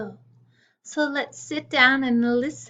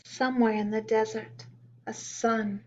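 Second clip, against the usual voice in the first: put-on reading a poem to children; 3 semitones higher, 8 dB louder than usual. A narrator's reading.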